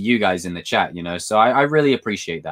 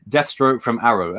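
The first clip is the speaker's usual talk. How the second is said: ordinary talk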